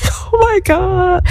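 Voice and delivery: high, drawn-out imitated wail; mock crying. Retelling her shock as the church door opens, mock crying. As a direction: wail it slowly, way up high, acting overwhelmed. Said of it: "Now I'm just buku cry".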